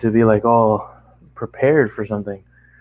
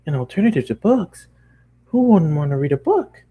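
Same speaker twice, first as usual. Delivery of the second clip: high, rising disbelief; can't believe anyone skips books; a bookish pony aghast at television; amazed pony; 7 semitones higher than usual. Acted.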